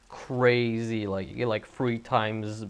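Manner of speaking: ordinary talk, nothing marked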